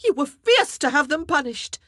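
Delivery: queen retorting to the king, indignant; sharp retort. Acted. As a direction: throw it straight back. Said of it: "she retorted".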